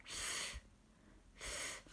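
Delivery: unvoiced Th hissed through the teeth; soft th sound. Shown as a demonstration. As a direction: breathe out th with the tongue between the teeth. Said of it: "Put your tongue between your teeth".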